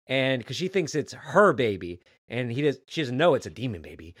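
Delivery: usual speaking voice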